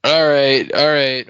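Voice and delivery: loud, hurried stop; urgent interruption. Real, not acted. Cohost halts the spoiler email, loud and urgent. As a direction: cut her off before the spoiler.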